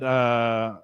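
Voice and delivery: usual speaking voice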